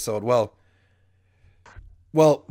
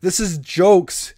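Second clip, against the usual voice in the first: slow emphatic; 5 semitones higher, 5 dB louder, much slower than usual. Real, not acted. Insisting he was only joking.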